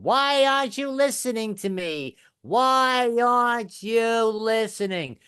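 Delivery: high, drawn-out mock shriek; yelling wife. New Jersey wife yelling at her husband mid-argument, high stretched-out mock yell. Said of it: "she always yells the same thing".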